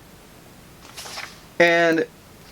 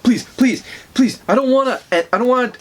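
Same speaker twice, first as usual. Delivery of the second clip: higher-pitched, broken-off begging; scared pleading; 6 semitones higher than usual. Retold or imitated. Thawne begging as he sees this thing, frightened. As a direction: plead in acted panic, repeating yourself.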